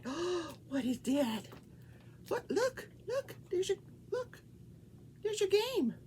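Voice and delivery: high gasping wonder for the pet; high, breathless oh-look voice; excited pet talk. Real, not acted. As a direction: make the game sound thrilling to him. Showing the pet its game.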